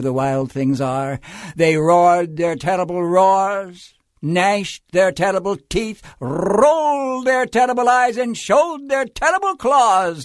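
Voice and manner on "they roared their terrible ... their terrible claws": narration climbing higher and louder; dramatic storytelling build. A narrator's reading. Wild things menace Max on arrival, loud rising narration. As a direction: build each phrase bigger, punching the monster verbs.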